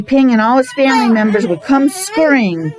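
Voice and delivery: normal talking voice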